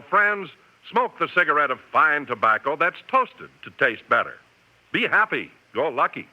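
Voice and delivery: usual speaking voice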